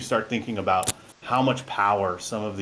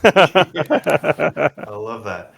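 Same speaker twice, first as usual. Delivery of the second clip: big laugh into the words; loud, laughing delight; 7 semitones higher, 11 dB louder, much slower than usual. Real, not acted. Laughing at a joke.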